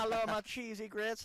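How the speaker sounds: high, loud mock Southern accent; Reeves Jackson. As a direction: tease a colleague with a hammed-up Southern voice. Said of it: "That's how you sound in the press box".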